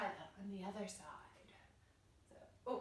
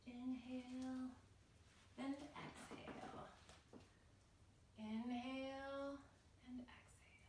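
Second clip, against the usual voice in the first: calm breathing cue; 3 semitones higher, 10 dB quieter than usual. Real, not acted. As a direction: draw out each breath cue gently. Leads children's breathing to relax.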